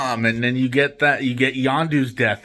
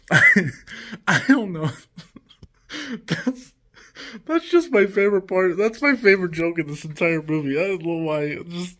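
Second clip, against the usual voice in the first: higher-pitched, talking through laughter; really cracking up; 4 semitones higher than usual. Real, not acted. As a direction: struggle to speak while laughing at the joke. Cracking up at his favorite joke.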